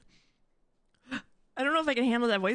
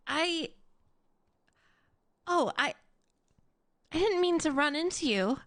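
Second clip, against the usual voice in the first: put-on higher, breathless flustered girl; girly character voice; 6 semitones higher than usual. Imitating the flustered heroine, higher and stammering. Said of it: "so weird".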